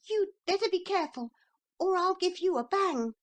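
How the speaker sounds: put-on a bold little boy squaring up; little boy threatening a horse, high and fast; small boy. A narrator's reading.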